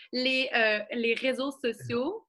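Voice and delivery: les réseaux sociaux, said slowly and spaced out; slowing down. Real, not acted. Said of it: "je parle trop vite".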